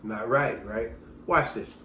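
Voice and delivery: ordinary delivery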